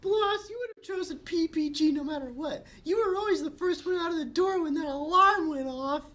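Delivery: put-on very high, bright girly voice; squeaky cheerful sister; Bubbles voice.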